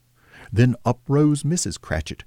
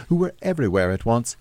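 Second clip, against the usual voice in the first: ordinary delivery, nothing marked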